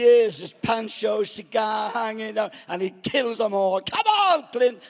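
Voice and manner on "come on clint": loud, high cheer for the hero; urging Clint on with a loud cheer; cheering shout. Retold or imitated. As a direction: yell it like a fan in the cinema.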